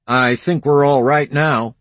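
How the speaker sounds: high, light cowboy voice; easy young cowboy. A narrator's reading. Cowboy answers owner.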